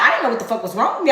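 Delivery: ordinary delivery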